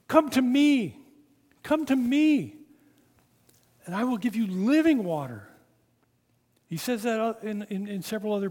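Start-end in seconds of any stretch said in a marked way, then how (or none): 0.1-1.0 high, loud, falling me; shouted plea. A narrator's reading. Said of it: "shouts out". 1.6-2.6 high, loud, falling me; shouted plea again. A narrator's reading. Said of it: "shouts out". 3.8-5.5 high, loud call; shouting. A narrator's reading. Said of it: "shouts out".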